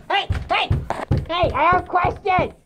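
high-pitched, insistent demand; urgent calling out. Real, not acted. Calling the authority to remove a cat, urgent demand. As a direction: call for attention, pitched well up.

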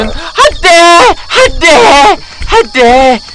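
high, loud mock-panicked cries; acted panic. Shown as a demonstration. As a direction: demonstrate someone flustered, wailing it out. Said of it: "이렇게 당황하는".

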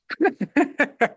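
a high, breathy laugh; high burst of laughter. Real, not acted. Laughing at the granddaughter's "gross" remark, high burst of laughter. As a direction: laugh out at the punchline.

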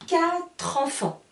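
slow quatre enfants joined as katr-enfants; model, katr-enfants; linked quatre enfants. Shown as a demonstration. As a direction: join quatre and enfants slowly. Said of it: "Je regroupe les deux mots".

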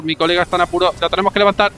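usual speaking voice

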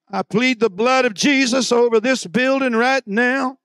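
preacher prays aloud over the church; fervent shouted prayer. Acted. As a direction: cry out the prayer over the building.

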